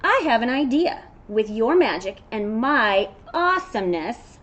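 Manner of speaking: loud and high, bursting with an idea; storybook goat full of enthusiasm; excited goat. Acted. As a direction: pitch the big team-up.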